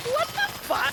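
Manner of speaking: sudden high squeal of outrage; sky-high incredulous outburst; outraged disbelief. Real, not acted. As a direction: react in shocked disbelief. Outraged reaction.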